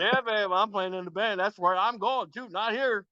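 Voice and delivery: high, singsong mock answer; playful retort in a lifted voice; teasing reply. Quoting his own reply.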